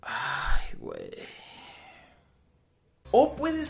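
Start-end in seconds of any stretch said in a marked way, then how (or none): 0.0-1.1 a breathy, sighed "ay, güey"; exhausted sigh. Retold or imitated. Tired listener sighs at the angry person, breathy and sighed. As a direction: sigh it out, worn down. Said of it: "te dejan suspirando".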